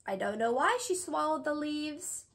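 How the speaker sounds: ordinary delivery, nothing marked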